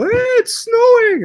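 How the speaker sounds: high, loud, drawn-out mimicked excitement; mock excited squeal. Mimicking Texas friends over a snow dusting, squealing excitedly. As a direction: imitate friends thrilled by a little snow.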